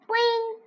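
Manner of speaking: high springy boing; frog boing. A narrator's reading. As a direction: twang it like a frog. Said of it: "Og said".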